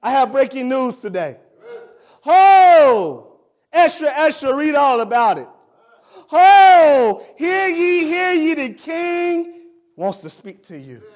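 loud proclaiming herald cry; herald shout. Acted. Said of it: "This is what Isaiah is saying".

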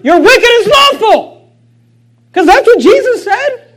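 high, loud, slow shout; fiery rebuke. Real, not acted. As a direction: thunder the accusation. Preaching rebuke.

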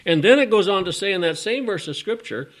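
loud, high preaching voice. Real, not acted. Preacher builds to full force.

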